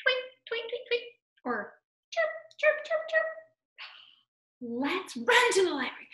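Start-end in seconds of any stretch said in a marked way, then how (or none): 0.0-1.0 high little piping tweets; short, high pretend bird calls; bird tweeting. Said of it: "And a bird says". 2.1-3.3 very high, quick chirps; short, piping pretend chirps; bird chirping. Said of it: "And a bird says".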